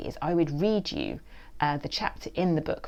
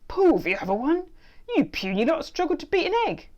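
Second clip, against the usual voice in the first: gruff hamster; 12 semitones higher, 6 dB louder than usual. A narrator's reading. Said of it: "its voice gruff and incredibly deep".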